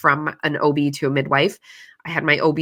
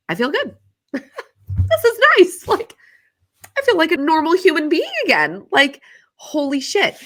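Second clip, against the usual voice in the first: much higher, delighted disbelief; high, light, amazed and relieved; giddy relief; 13 semitones higher than usual. Real, not acted. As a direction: gush with sudden relief. Relief after medication.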